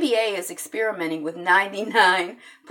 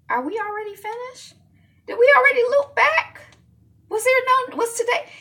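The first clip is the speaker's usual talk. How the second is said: surprised; 5 dB louder than usual. Real, not acted. Confused she already covered story.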